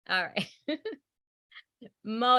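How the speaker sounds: ordinary delivery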